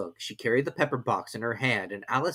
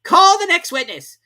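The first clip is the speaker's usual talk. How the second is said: high, loud summons; high, loud king; king calling out; 14 dB louder than usual. A narrator's reading. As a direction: call across the court. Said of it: "said the king".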